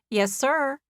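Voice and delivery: a cheerful nurse answering over the intercom. A narrator's reading.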